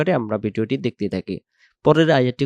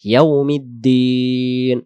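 "ইয়াউমিদ্দিন" slow, with long held final ee; drawn-out DIN; 4 dB louder, much slower than usual. Shown as a demonstration. Teacher modelling "ইয়াউমিদ্দিন" for learners, drawn-out দিন. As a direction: stretch the দিন at the end of "ইয়াউমিদ্দিন". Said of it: "দিন টান দিয়ে পড়বো".

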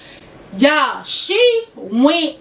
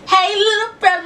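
bright, higher call to him; excited greeting; 6 semitones higher than usual. Real, not acted. Shouting out her little brother on camera, excited. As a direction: sing out a hello to him.

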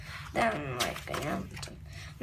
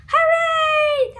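high, loud cheer; 10 semitones higher, 8 dB louder than usual. Acted. Kids celebrating their comic, loud cheering. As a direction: shout it with joy.

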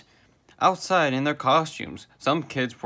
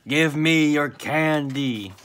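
slow, higher, loud spooky demand; drawn-out yell; 4 semitones higher, 5 dB louder, slower than usual. A narrator's reading. SpongeBob haunting the party for candy, drawn-out yell. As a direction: stretch every word as you call out. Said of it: "yelled SpongeBob".

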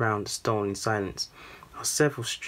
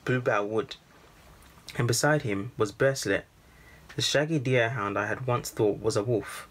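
ordinary delivery

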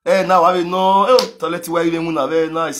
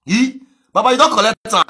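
usual speaking voice

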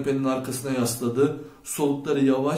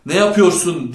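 loud, higher angry roar; narrator's full-voiced furious shout; 4 semitones higher, 9 dB louder than usual. A narrator's reading. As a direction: roar the question in outrage. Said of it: "diye kükredi Chet".

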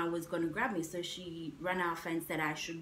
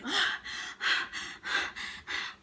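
high, airless gasping, mimicking her breathless friend; winded friend after the run, gasping for air; imitated gasp for breath; 11 semitones higher than usual. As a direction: act out a friend too winded to speak. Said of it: "she was like".

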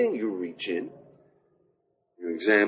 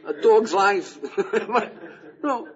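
amused punchline; 10 semitones higher, 7 dB louder, slower than usual. Real, not acted. Joke punchline about dog talk.

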